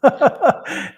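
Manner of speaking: high, loud laugh; a short real laugh; burst of laughter. Real, not acted. Host laughing at the skeptic's question. Laughs at her own joke.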